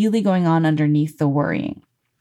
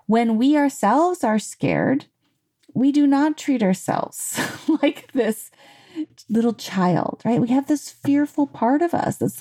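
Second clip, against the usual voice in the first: normal talking voice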